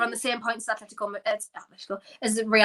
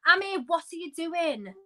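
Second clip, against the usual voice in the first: slow, higher, incredulous complaint; exasperated; 4 semitones higher, 3 dB louder than usual. Real, not acted. Fan railing at Herrera coming on, exasperated. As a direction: spell out the outrage slowly. Rails at substitute choice.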